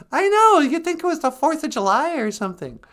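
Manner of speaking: very high, loud chatty voice; high-pitched cartoonish reply; squeaky sidekick. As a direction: pipe up as a tiny sidekick.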